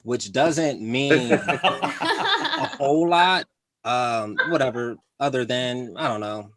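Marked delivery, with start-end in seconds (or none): 0.3-3.4 laughing. Real, not acted. Laughs at own joke.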